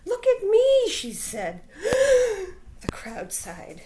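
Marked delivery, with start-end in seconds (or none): 0.1-0.9 high, loud, excited show-off; delighted squeal. Acted. Little prairie dog showing off her fuzz to the crowd, excited. 1.7-2.8 high loud gasp of delight; crowd gasp. Acted. Crowd of prairie dogs gasping at Pip, amazed.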